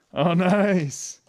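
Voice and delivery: bursting into a delighted laugh; excited laugh. Real, not acted. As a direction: laugh it out, thrilled. Tastes the gift, delighted.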